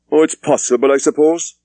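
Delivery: half-hearted agreement; mild doubtful reply. Acted. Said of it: "said Thomas doubtfully".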